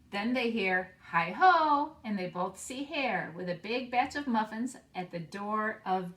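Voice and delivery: put-on expressive read-aloud for young children; story narration. Teacher reading a picture book to her class, expressive read-aloud. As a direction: read the picture book warmly and slowly, bringing each animal to life.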